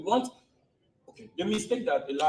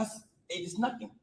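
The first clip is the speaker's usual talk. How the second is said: normal talking voice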